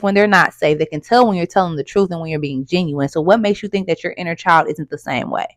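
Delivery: ordinary talk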